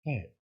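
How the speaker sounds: "hair" softly, with an airy h; "hair" begun like a weak Spanish jota; soft English H. Shown as a demonstration. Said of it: "una J medio G solapada".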